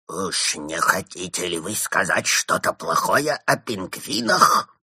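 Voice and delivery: slow, hoarse villain's question; sinister rasp, rising to the end; creepy hoarse voice. A narrator's reading. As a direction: menace them slowly in a creepy croak. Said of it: "жутким, хриплым голосом".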